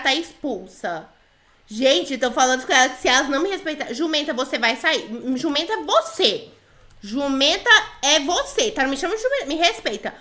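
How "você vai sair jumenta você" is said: ordinary delivery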